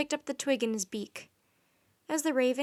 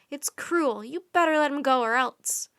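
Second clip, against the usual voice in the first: angry; 3 semitones higher, 7 dB louder than usual. A narrator's reading. Boy threatens the Raven King.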